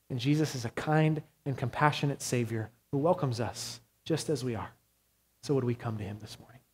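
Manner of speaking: usual speaking voice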